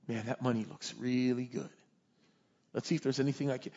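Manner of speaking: low, soft thinking aloud; tempted musing. Acted. Prophet eyeing the king's money, quietly tempted. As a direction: talk yourself into it under your voice.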